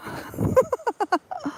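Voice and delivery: a soft high giggle; quiet squeaky laugh under her breath. Real, not acted. Laughs after saying Gonk.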